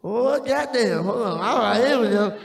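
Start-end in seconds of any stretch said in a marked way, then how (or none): none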